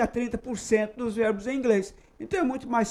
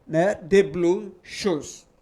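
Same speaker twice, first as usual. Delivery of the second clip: slow model sentence; much slower than usual. Shown as a demonstration. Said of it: "Então, eu diria".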